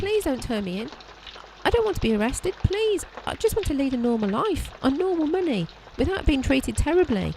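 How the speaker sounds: high, begging, rising panic; desperate pleading. Acted. Maid begging the detective not to turn her in, high and desperate. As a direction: beg for your freedom.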